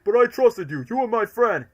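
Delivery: loud, high cry of betrayal; hurt shout. Retold or imitated. Fan quoting a betrayed Eggman, hurt shout. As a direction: yell it like a betrayed friend. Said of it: "quoting an upset Ivo".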